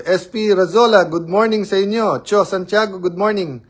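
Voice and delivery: high, loud calling out of viewers' names; loud greeting. Real, not acted. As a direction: hail each viewer by name with a big good morning. (malakas na pagbati sa mga nanonood)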